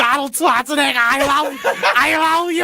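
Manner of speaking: high, bad Schwarzenegger voice; a weak, hammed-up Arnold impression. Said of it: "I can't do impressions".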